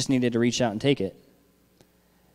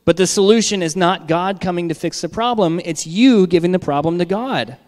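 louder, higher preaching climax; raised preacher's voice; preaching at full force; 5 semitones higher, 6 dB louder than usual. Real, not acted. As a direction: drive the point home to the church. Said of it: "preaching climax".

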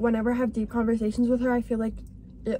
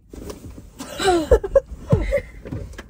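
mock gunshot gasp; 9 semitones higher than usual. Girl showing friends her bored-in-class act, mock shot gasp. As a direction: gasp as if suddenly hit, playing. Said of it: "act like you got shot".

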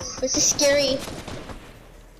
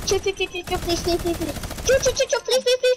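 frantic begging; 7 semitones higher, faster than usual. Real, not acted. Begging enemy while under fire.

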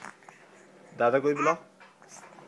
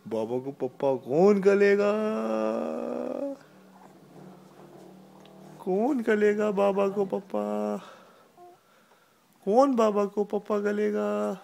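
put-on baby talk; 5 semitones higher than usual. Parent baby-talking to daughter.

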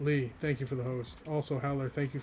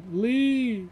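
a high, loud, delighted call of Lee; excited call; 10 semitones higher, 9 dB louder than usual. Real, not acted. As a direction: shout Lee's name with joy as he joins the stream. Greeting a viewer who joined.

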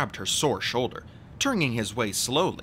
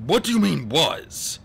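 loud, raised stern question; stern, higher-pitched challenge; 6 semitones higher, 6 dB louder than usual. A narrator's reading. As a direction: demand an explanation sternly. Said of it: "Mister Poe asked sternly".

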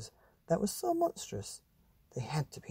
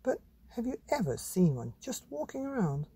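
high breathy mum voice; airy higher mother; mum's voice; 9 semitones higher than usual. Acted. Mother explaining real animals, high airy voice. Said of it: "said Mum".

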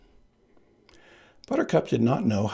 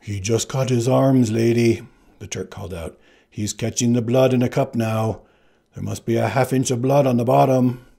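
Turk calling out; 2 semitones higher, 6 dB louder than usual. Acted. Turk helping the bluff, calling out. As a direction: call over the water.

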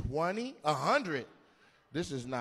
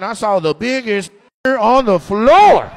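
much louder, slow auction boast; loud slow mock auction voice; slave trader voice; 5 semitones higher, 18 dB louder, slower than usual. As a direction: bellow like a proud auction buyer. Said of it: "why are you talking like a slave trader".

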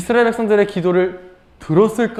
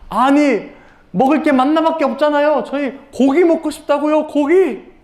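high-pitched whiny grumble; complaining; 8 semitones higher than usual. Acted. Israelites grumbling they want meat, high, acted complaint. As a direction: act out an indignant demand. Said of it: "불평을 하기 시작했어요".

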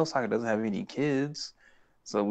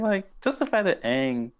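normal talking voice

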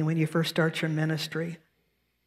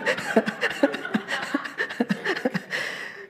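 high breathless laughter at herself; real laugh; 7 semitones higher than usual. Real, not acted. Minister laughing while retelling a harsh sermon review. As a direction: laugh it off warmly, airy and high. Woman telling what she disliked.

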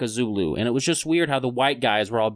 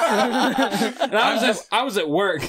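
high, laughing retelling; laughing, excited; 9 semitones higher than usual. Real, not acted. Telling friends the war news, laughing and high.

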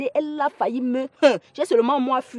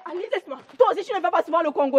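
high, hurried begging; desperate pleading; 7 semitones higher, much faster than usual. Acted. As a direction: talk her out of it in a panic. Begs as Alice refuses.

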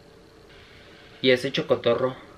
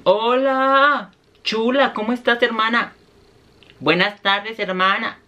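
high, bright gushing hello; excited greeting; 7 semitones higher than usual. Real, not acted.

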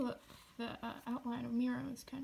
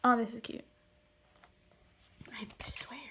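ordinary talk, nothing marked

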